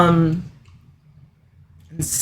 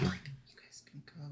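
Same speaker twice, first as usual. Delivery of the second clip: barely voiced, slow murmur; under the breath; 26 dB quieter, slower than usual. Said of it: "I should say that louder for the microphone".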